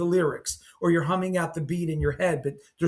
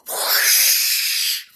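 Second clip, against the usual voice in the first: long, slow breathy whoosh, no voice; whoosh sound; 4 dB louder than usual. Retold or imitated. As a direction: blow out a hollow whooshing rush. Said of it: "it sounded like we entered the tomb".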